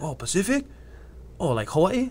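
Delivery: high-pitched, rising surprised questions; imitated surprise. Retold surprised reaction to hometown.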